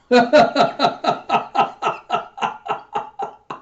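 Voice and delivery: high, breathy laughing fit; long peal of giggling laughter; real laughter. Real, not acted. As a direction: crack up helplessly. Cracks up while reading.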